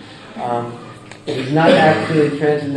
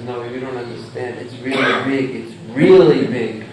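softer, lower, lightly voiced frog insisting; patient ocean frog; 6 semitones lower, 3 dB quieter than usual. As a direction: explain gently, it's really big. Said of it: "the frog who's seen the ocean says".

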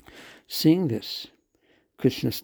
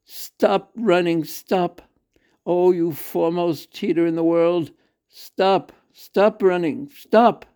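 loud, urgent shouts to stop; calling out; 5 semitones higher, 7 dB louder than usual. A narrator's reading. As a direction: call after a runaway child.

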